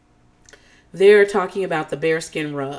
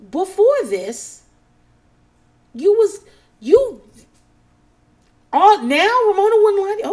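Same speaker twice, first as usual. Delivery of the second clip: mocking disbelief; 12 semitones higher, 7 dB louder than usual. Real, not acted. Mocking Renee's reversal.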